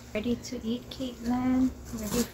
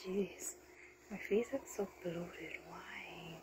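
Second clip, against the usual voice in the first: whispering; 16 dB quieter, slower than usual. Real, not acted. Whispered self-remark.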